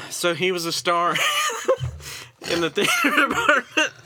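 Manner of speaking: resume, then crack up mid-sentence; high squeaky laughter cutting through the words; breaking into laughter. Real, not acted. Tries again, breaks into laughter.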